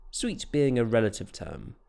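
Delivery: ordinary delivery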